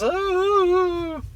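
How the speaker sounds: a squeaky, falsetto little oh; mock stunned oh. Mimicking the girlfriend as he kicks her out, high stunned oh. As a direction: play her caught off guard, in a high mock voice. Mocking the girlfriend being kicked out.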